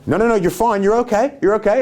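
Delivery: high, hurried mock reassurance; panicked reassuring sibling. Older sibling avoiding trouble after hitting the little one, frantically reassuring. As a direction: talk a child out of crying, high-pitched and urgent.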